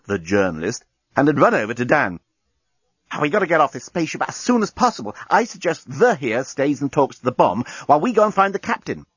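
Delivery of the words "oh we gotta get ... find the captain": urgent and hurried. Acted. Lucy urgent, fast.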